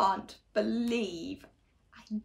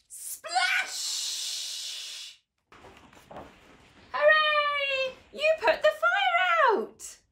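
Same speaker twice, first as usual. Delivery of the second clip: cheering; slower than usual. Acted. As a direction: cheer the child on wildly.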